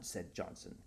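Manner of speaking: ordinary delivery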